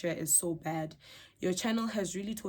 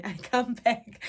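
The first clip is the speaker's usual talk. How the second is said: laughing; 4 semitones higher, 6 dB louder, slower than usual. Real, not acted. Laughs reading loyal viewer comment.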